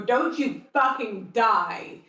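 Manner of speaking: slow, emphatic in-character shout; fierce command. Acted. Character pointing down at fallen Bertie, fierce shouted command. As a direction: call it out word by word.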